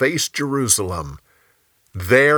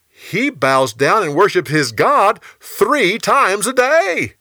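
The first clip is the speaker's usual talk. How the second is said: high, slow voice of tattling officials; feigned regret; 9 semitones higher, 5 dB louder than usual. Acted. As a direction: draw out the accusation to the king in a high voice. Officials inform on Daniel.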